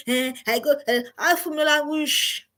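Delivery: half-hearted Nigerian Pidgin grumble; Nigerian Pidgin whine. Retold or imitated. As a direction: Nigerian Pidgin, mildly whining. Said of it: "I wanted to say".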